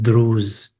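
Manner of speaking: normal talking voice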